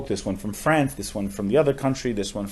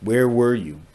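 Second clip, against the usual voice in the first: retold protest; 3 dB louder, slower than usual. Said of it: "he protests".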